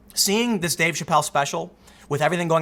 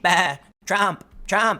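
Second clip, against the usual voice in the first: a light sheep bleat on "Bah" and "Trump"; much slower than usual. Retold or imitated. Mocking the crowd as sheep, with a light bleating voice. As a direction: bleat the words like a mindless sheep. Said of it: "bleating like sheep".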